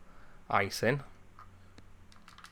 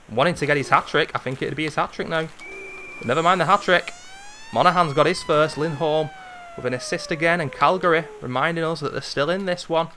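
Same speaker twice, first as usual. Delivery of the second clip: higher, louder play-by-play; lively commentary; 5 semitones higher, 3 dB louder than usual. Acted. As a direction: call the Calgary goal with pace. Goal play-by-play.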